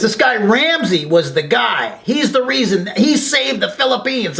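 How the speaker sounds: a lightly voiced old vet pressing his point; old veteran.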